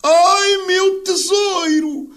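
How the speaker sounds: high, drawn-out mock cry of ecstasy; imitating her cries. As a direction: wail it slowly, mimicking the wife's cries. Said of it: "começou a gritar assim". (imitação de gritos agudos e arrastados)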